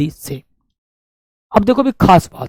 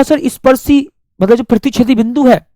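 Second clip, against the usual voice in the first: imitating student; 6 semitones higher than usual. Teacher mimicking a student's question, high and eager. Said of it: "और आपने कहा".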